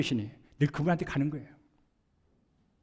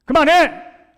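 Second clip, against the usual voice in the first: 아내에게 버럭 소리치는 재연; 높고 크게 터지는 고함, 점점 거세지며; shouting at wife; 2 semitones higher, 7 dB louder than usual. Retold or imitated. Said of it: "라고 소리를 지르는 거예요". (아내에게 화가 나 "그만해"라고 소리치는 남편의 고함)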